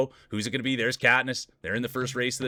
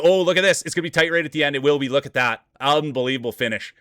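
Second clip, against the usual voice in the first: excited finish call; 2 semitones higher, 6 dB louder than usual. Real, not acted. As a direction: call the tight finish with rising thrill. Photo finish of marble race.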